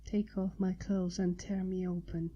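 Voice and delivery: hushed, half-whispered urging. Acted. Woman urging her partner, half-whispered. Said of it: "she whispered".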